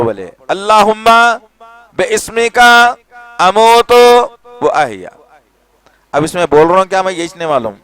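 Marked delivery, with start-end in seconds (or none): 0.5-5.1 slow, raised, chanted Arabic supplication; reciting a prayer. Shown as a demonstration. Preacher teaching the bedtime prayer, slow devout recitation. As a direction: intone each word slowly, higher and louder. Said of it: "ये दुआ पढ़ो".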